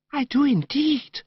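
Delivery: put-on high, breathless girlish gush, slowly savoured; gushing, dreamy young woman. Narrator voicing Madeline to Bertie in the park, high dreamy gush. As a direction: coo it like a sentimental girl.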